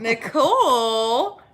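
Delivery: calling out. Real, not acted. Calling out to viewer.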